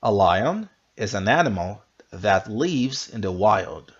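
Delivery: slowed, careful re-read; slow repeat. Shown as a demonstration. Teacher repeating slowly so students can follow. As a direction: go through it slowly word by word. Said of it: "One more time".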